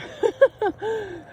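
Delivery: high burst of chuckling; laugh. Real, not acted. Storyteller admitting they forgot the gun, laughing high. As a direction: laugh at your own mistake.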